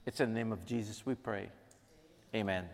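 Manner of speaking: soft, reverent prayer voice. Acted. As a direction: pray quietly to God for the room. Prayer closing.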